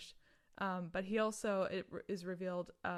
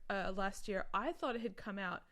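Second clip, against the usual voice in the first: normal talking voice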